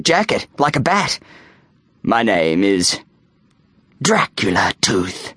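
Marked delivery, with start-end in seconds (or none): 2.0-5.3 low, slow ominous introduction; vampire hiss. A narrator's reading. Boy revealing his vampire name to the bully, hissed low. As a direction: drop the voice low and draw out the name. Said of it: "he hissed".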